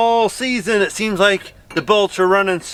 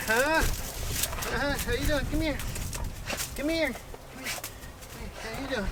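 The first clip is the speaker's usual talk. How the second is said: soft pet talk; 9 dB quieter than usual. Real, not acted. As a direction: coo gently at the happy dog. Greeting the dog, cooing.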